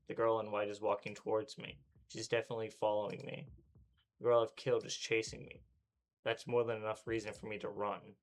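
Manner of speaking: usual speaking voice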